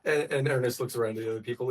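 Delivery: normal talking voice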